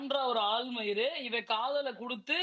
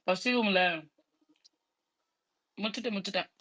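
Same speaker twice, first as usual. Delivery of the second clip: mouth full; 5 semitones lower, 10 dB louder than usual. Real, not acted. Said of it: "சோறு வாயில இருக்கு".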